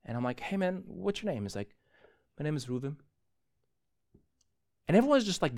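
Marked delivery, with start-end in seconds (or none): 0.4-1.4 soft, higher-pitched careful question; quiet, tentative reenacted greeting; retold gentle approach. Gentle approach after boy calms. 2.4-3.0 soft, subdued answer; small, hushed retold reply; imitated calm reply. Imitates the now calmed boy.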